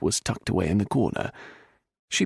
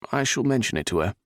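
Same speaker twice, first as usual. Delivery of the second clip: ordinary delivery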